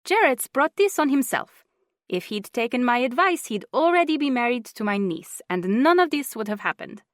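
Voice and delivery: bossy aunt, high and loud; older woman voice. A narrator's reading. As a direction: scold like a stubborn aunt. Juanita voiced.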